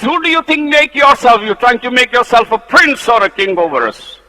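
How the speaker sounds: high, loud scornful taunt; mock Moses for playing king; sneering rebels. Rebels accuse Moses of making himself a prince, sneering.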